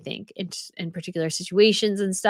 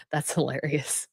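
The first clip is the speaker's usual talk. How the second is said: laughing whisper; 5 semitones lower, 6 dB quieter than usual. Real, not acted.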